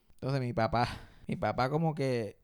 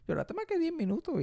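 mock incredulous dad; 12 semitones higher than usual.

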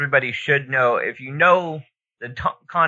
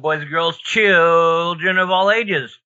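slow booming circus announcer; ringmaster; 4 semitones higher, 4 dB louder, slower than usual. Pretend. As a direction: roll it out like a ringmaster. Show intro announcer opening.

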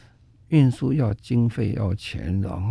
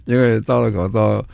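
laughing; 4 semitones higher, 6 dB louder than usual. Real, not acted. Laughs about breathing in mask.

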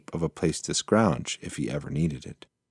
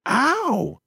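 much higher sharp mouse yelp; pained yelp. Acted. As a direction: yelp as fur is pulled. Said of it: "yelled Hansa".

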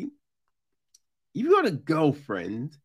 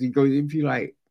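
ordinary talk, nothing marked

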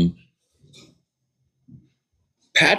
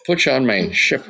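Irish pirate impression; 5 semitones higher than usual. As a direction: play a jokey, hammed-up pirate. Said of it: "an Irish pirate would be like".

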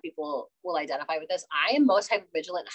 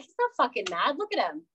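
mock exasperation; 12 semitones higher than usual. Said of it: "I'm like".

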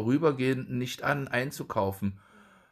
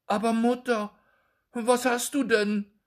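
startled question; 13 semitones higher, 3 dB louder than usual. A narrator's reading. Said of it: "fragte Jakob ganz erschrocken".

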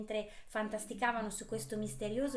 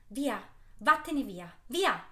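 sudden scornful shout; contemptuous outburst; 2 semitones higher, 7 dB louder, slower than usual. Acted. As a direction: drive her off with disgust. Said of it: "esclamò d'improvviso il vecchio ... sprezzante". (esclamazione sprezzante)

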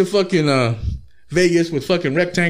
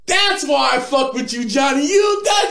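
much higher, rising into a shout; buddy gassing him up, yelling happily; excited shouted praise; hyped praise; 11 semitones higher than usual. Retold or imitated. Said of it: "And [name]'s like".

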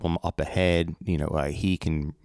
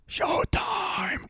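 drawn-out, high-pitched mock call; voice command to remote; 14 semitones higher than usual. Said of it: "press the button and say".

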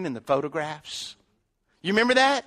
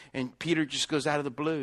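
normal talking voice

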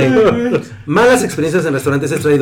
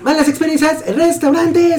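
announcer voice; 12 semitones higher than usual. Pretend. Announcer style title read.

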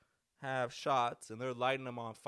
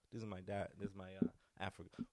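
ordinary talk

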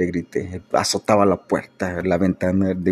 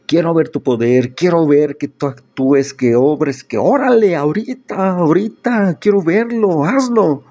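louder, much higher, building to a near shout; fist-clenched demand; 9 semitones higher, 4 dB louder than usual. Retold or imitated. Preacher reenacting pounding the table at God, furious shout. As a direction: hurl the dare at God, climbing in pitch. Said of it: "diciéndole a puño cerrado".

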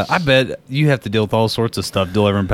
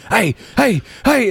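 barking dog voice; 11 semitones higher than usual. Pretend.

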